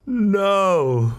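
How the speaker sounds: high, loud gasp of disbelief; stunned cry at the crash; shocked no. Real, not acted. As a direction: react as if you saw the crash. Reacting to crash story.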